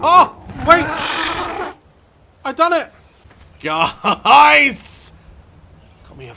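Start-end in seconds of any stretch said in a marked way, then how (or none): 0.0-5.1 loud, high triumphant yells; excited shouting. Real, not acted. Tamed a flying dinosaur.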